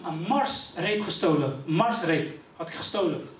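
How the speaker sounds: ordinary talk, nothing marked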